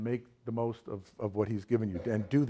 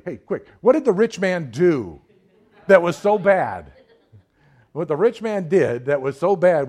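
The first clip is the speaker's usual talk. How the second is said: preaching at full force; 6 semitones higher, 10 dB louder than usual. Acted. Preacher pressing the congregation about the rich man, loud and driving. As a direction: pound each phrase home to the congregation.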